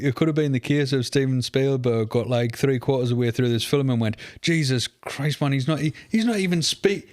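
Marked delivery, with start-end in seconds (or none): 4.4-7.1 rising pitch, baffled and fed up; exasperated director. Retold or imitated. Podcaster voicing Spielberg losing patience with the accent, exasperated. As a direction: throw your hands up as the director mid-shoot.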